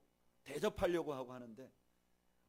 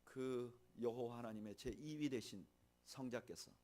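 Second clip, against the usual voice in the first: ordinary delivery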